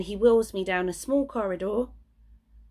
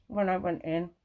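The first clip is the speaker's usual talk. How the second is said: ordinary talk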